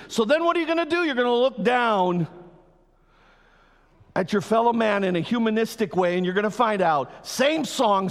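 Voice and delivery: loud, high and fast, warning the crowd; fiery prophetic warning. Acted. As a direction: thunder out the prophecy, rushing ahead. Preacher acts out Isaiah's warning.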